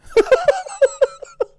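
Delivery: shrieking burst of laughter; high, loud, real cackle. Real, not acted. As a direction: crack up loudly at chat. Laughing at troll in chat.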